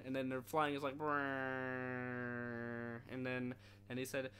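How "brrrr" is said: lips buzzing like a plane engine; plane engine rumble. Retold or imitated. Friend imitates plane flying, engine brrr.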